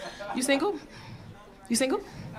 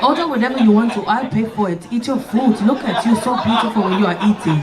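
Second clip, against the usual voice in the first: lower, coaxing mock date voice; imitating the date; 4 semitones lower than usual. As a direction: play the creepy admiring date, lightly voiced. Date voice continues.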